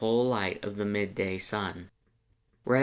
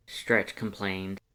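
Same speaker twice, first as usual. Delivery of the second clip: plain reading voice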